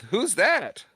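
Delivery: high-pitched innocent question; imitated clueless kid. New kid chiming in to the gang, imitated clueless question. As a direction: pipe up high like a naive kid.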